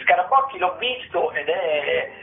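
normal talking voice

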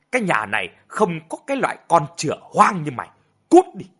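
loud, high-pitched angry tirade. A narrator's reading. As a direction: curse and drive the child out in fury. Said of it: "Ông Tự tức giận". (giận dữ quát đuổi)

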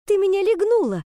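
high, loud accusing outburst; indignant exclamation. A narrator's reading. Kicked girl accusing Penny, indignant exclamation. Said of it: "воскликнула я".

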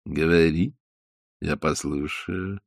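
low, soft and slow; gently, like a kind old fish; tender. A narrator's reading. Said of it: "ласково ответила рыба".

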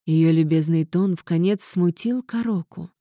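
ordinary delivery, nothing marked

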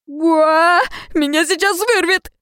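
high retching outcry; disgusted cry. A narrator's reading. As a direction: gag out your disgust. Slobbered on, about to vomit.

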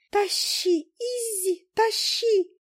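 very high, squeaky wailing voice; a tiny fly wailing and urging. Acted. The fly running on Izi's head, wailing very high. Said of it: "причитала Жужа".

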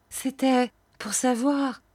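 high, timid question at ordinary volume; timid trembling. A narrator's reading. Frightened seller addresses old shopkeeper, timid and high. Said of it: "dis-je en tremblant".

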